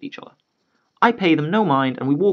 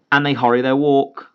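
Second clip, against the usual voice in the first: usual speaking voice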